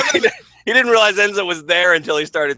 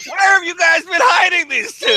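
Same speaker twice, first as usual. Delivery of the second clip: high loud gushing; mock gushing fan; 4 dB louder than usual. Teasing his cohost, a high loud gushing fan. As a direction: ham it up like a late fan.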